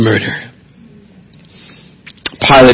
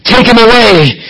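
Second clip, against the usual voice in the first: a raised, emphatic call; emphatic cry; 9 semitones higher than usual. Retold or imitated. The crowd demands Jesus be taken, raised emphatic cry. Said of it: "It was emphatic".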